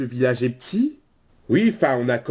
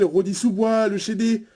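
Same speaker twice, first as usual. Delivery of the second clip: ordinary talk, nothing marked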